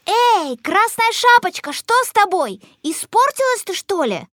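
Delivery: put-on high-pitched children's calling out; children shouting. A narrator's reading. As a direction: call up high to someone behind glass. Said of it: "кричать и стучать в окно".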